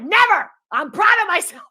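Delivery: high, loud, defiant retort; indignant outburst. Retold or imitated. Answering Germans who say marry, loudly defiant. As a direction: burst out in proud mock outrage.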